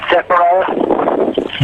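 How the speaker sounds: Zechariah drawn out slowly, each syllable separate; slow careful pronunciation. Shown as a demonstration. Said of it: "Do that again".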